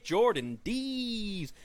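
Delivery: shouted, high, slow wrestling intro; announcer hype. Acted. Host introducing jobber to listeners, ring announcer shout. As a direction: call out the name like a ring announcer.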